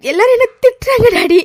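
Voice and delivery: high sobbing complaint; crying. Acted. Daughter in jail telling her father everyone scolds her, high sobbing. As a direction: let the cry break through. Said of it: "நீ அழாதம்மா".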